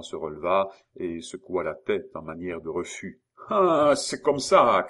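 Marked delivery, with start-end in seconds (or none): 3.5-4.9 loud, high, drawn-out threat; angry shout. A narrator's reading. Gaoler reacting to the child's refusal, shouting. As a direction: raise the voice in menace. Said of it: "cria la même voix".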